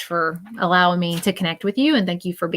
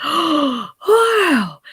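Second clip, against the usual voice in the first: awed wow; 8 semitones higher than usual. Retold or imitated. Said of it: "he's like".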